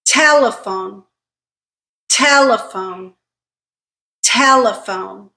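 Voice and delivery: Telephone with strong "Te" and a weak schwa "le"; slow, careful Telephone, first syllable stressed; stress pattern demo. Shown as a demonstration. Teacher has learners repeat Telephone, careful isolated stress. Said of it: "Pay attention to the stress pattern".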